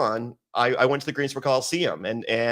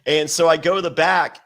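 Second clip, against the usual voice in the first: usual speaking voice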